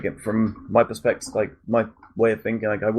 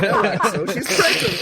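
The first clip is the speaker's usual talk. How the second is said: much higher, laughing protest; laughing mock plea; 14 semitones higher than usual. Pleading with Axel about the pregnant passenger, laughing and high.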